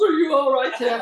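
high and loud, anxious concern; excited worried call. Retold or imitated. Man jumping from car asking Tim, high and urgent. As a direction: burst out with worry while hugging him. Guy hugs him, worried.

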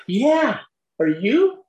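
high, loud retold surprise; imitating an astonished stranger; startled recognition. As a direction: burst out high, swooping up into the question. Seatmate stops, recognizes fellow member.

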